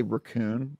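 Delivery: "raccoon" with a reduced first vowel, ruh-COON; "raccoon" stressed at the end; odd syllable emphasis. Shown as a demonstration. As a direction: say "raccoon" leaning on the second syllable. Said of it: "the emphasis on that syllable".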